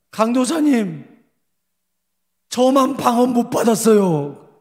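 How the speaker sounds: high, slow mock-crying whine; imitated tearful complaint. Pastor voicing a student left out of tongues, tearful complaint. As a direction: complain through pretended tears, drawn out. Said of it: "막 울어요".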